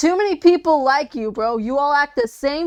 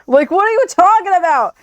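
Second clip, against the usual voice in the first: ordinary delivery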